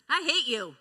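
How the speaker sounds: loud, sharp I hate you; a child yelling at a parent; child's angry outburst. Retold or imitated. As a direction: fling it like a furious kid. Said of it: "a child says".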